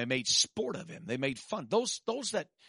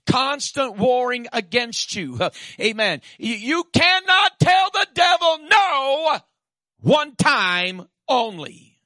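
full-force preaching; 9 semitones higher, 12 dB louder than usual. Acted.

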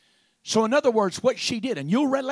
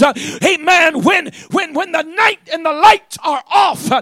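loud, driving pulpit cadence; preaching at full force; 4 semitones higher, 11 dB louder than usual. Acted. Preacher to people in life's dark hour, full-force preaching. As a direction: shout it to the congregation in punched phrases.

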